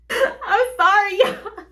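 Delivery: high and loud through real laughter; giggling sorry; laughing apology. Real, not acted. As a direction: apologize while still cracking up. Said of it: "I keep laughing so hard".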